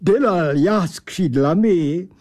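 affronted, pompous creature; irritated odd angel. Acted. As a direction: protest huffily in a thick accent.

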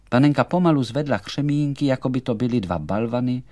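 plain reading voice

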